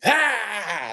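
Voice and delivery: short high yelp, hammed-up; a brief mock cry, pitched high; mock holler. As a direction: imitate a coach's startled holler. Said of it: "He went to hollering".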